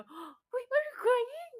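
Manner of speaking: high-pitched, loud alarmed question; startled concern. Retold or imitated. Friend noticing her crying, high and startled. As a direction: act out a friend startled by tears.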